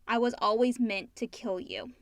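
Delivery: ordinary talk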